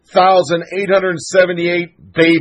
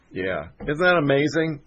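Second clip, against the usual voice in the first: ordinary delivery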